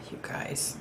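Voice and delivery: breathy whispered "You guys", voice dropped to nothing; whispered aside. Real, not acted. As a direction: whisper it in exasperation, barely audible. Exasperated aside about bad internet.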